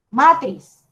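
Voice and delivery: "matriz" mis-stressed as MA-triz; wrong stress on first syllable. Shown as a demonstration. Teacher repeats the word they reject, "matriz" wrongly stressed on MA. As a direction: hit MA in "matriz", let the second syllable fall. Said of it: "no, eso no".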